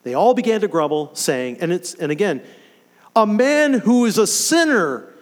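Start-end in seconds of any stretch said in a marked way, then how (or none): 3.2-5.1 "sinner" pushed high and louder, with a scornful edge; mild mock crowd scorn leaning on "sinner"; stress on sinner. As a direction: spit out "sinner" as the crowd's jab. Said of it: "emphasizing sinner man".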